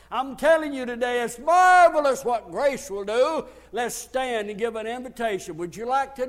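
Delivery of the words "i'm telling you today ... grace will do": preaching shout. Real, not acted. Preacher exclaiming about grace.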